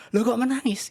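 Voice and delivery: kaget yang ditirukan, lebih tinggi dan keras; incredulous mock gasp of a question; heran. (sahabat heran, menirukan dengan nada tinggi)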